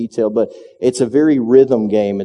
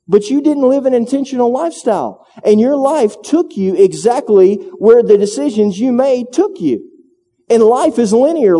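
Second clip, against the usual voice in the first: preaching at full force; 9 semitones higher than usual. Acted. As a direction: drive the point home to the congregation. Preaching builds.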